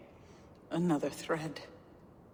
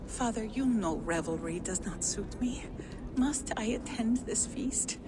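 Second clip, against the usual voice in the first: urgent whisper; 4 semitones higher than usual. Acted. Gunnlodr begging her father to skip the feast, whispered.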